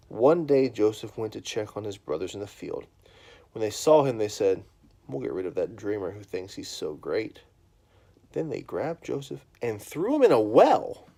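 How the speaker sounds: put-on loud, lively storybook reading to a child; reading to children. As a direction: read it big and animated for the kids. Reads a children's Bible story.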